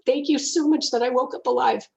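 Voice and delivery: choked-up thanks to the morning; almost tearful thanks for another day; emotional gratitude. Retold or imitated. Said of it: "I'm gonna get all emotional".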